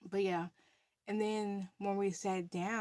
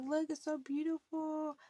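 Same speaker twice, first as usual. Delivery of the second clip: soothing high voice; 9 semitones higher than usual. Retold or imitated. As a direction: gently coax her to look. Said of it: "trying to calm her down".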